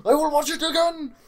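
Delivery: shrieked high and very loud; comic full-volume mimicked shout; mock accent yelling. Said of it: "We're just yelling now".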